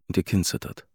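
ordinary delivery

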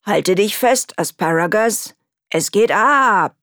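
a hint of an old man's call, slow at the end; lightly voiced grandfather calling out; grandfather's shout. A narrator's reading. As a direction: call out to hold on tight. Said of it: "wie ihr Großvater schrie".

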